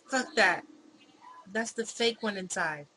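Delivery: loud, angry snap back; furious shout at the impostor; angry retort. Acted. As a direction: shout her down through the door. Angry retort through door.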